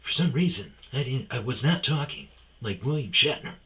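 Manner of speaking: high, loud, halting impression; William Shatner. Admitting to the audience he's slipped into it, Shatner delivery. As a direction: break the sentence up theatrically and push it out. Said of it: "I'm doing it now".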